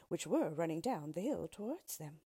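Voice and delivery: ordinary delivery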